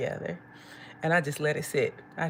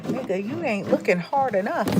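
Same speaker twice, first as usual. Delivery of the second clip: loud, higher-pitched mock outrage; exasperated retort; 5 semitones higher, 7 dB louder than usual. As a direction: fire it back loudly, pitched up. Incredulous retort to the man.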